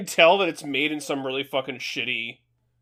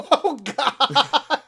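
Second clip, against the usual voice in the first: high, laughing "Oh, God!"; laughing exclamation; 8 semitones higher, 4 dB louder than usual. Real, not acted. Reacting to a friend's joke about his dog, laughing high. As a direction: burst out laughing.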